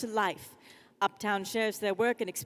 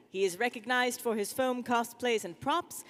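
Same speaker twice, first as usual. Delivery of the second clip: normal talking voice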